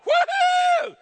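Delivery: piercing high, loud whoop; shouted goal celebration; cheering parent. Acted. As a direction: whoop as if your kid just scored. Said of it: "your kid hit, you know, hits a goal".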